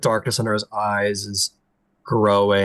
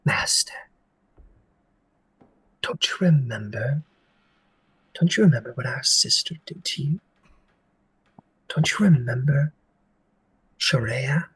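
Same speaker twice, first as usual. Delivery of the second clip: sisters' whisper; 2 semitones higher than usual. Acted. Sisters of Lament prodding the prince's trauma, hushed eerie voice. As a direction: lean in and hiss memories at him. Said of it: "and they speak, and they say".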